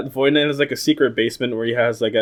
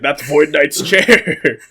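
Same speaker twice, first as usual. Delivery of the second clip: mock announcer; 3 semitones higher, 5 dB louder, much slower than usual. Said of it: "It's like".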